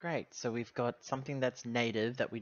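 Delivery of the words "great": normal talking voice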